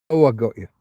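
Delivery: ordinary talk, nothing marked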